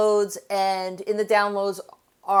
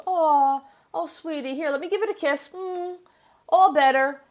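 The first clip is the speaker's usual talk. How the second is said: put-on higher, cooing mother's voice soothing a hurt child; tender mommy; 7 semitones higher than usual. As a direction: coo over the scrape like a loving mom. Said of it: "and mommy says".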